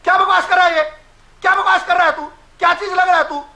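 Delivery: angry shout. Real, not acted. Panelist hurling insults at rival. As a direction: berate the heckler.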